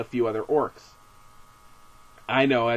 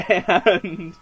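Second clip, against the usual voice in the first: laughing; 4 semitones higher than usual. Real, not acted. Laughing while telling the tree scene.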